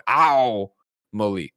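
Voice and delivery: 'Amelie' with a long, drawn-out 'OW' at the start; stress on first syllable. Shown as a demonstration. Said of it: "emphasis on the first syllable".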